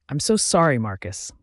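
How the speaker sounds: usual speaking voice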